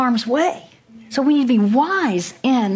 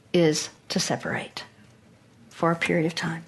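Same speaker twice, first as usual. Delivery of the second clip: hushed advice; 4 semitones lower, 6 dB quieter than usual. Retold or imitated. Pastor's advice, voice dropping.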